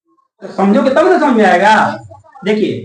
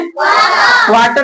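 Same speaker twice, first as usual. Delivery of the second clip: Quarter shouted high and slow; loud slow call; 11 semitones higher, much slower than usual. Shown as a demonstration. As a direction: ring out quarter so the class repeats it. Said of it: "क्या लिखे हमने?". (ऊँची आवाज़ में धीरे से quarter)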